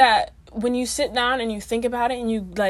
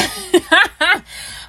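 high burst of laughter; 9 semitones higher, 9 dB louder than usual. Real, not acted. Laughs after "let me stop.

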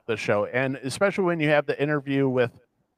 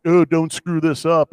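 slow, louder, a hint of a domineering colleague; lightly voiced condescending male lawyer; toxic male lawyer; 3 semitones higher, 6 dB louder, slower than usual. Male lawyer warning a female colleague, lightly mocked. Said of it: "the only male lawyer character who's like".

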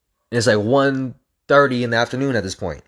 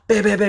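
high, punchy bam bam bam; rope slam noises; 10 semitones higher, faster than usual. Retold or imitated. Runner mimicking battle ropes with high bams.

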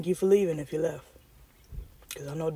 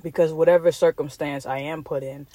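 normal talking voice

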